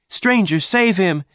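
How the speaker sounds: very high, loud plea; pleading cry. A narrator's reading. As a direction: beg him to save the man. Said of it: "Jane cried".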